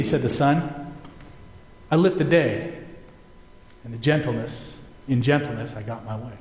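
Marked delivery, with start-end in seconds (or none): 1.9-6.3 gentle. A narrator's reading. Sun explains gentleness.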